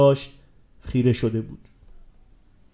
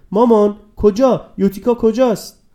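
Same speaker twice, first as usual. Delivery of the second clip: insisting; 6 semitones higher, 4 dB louder than usual. A narrator's reading. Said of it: "بیلی پافشاری کرد".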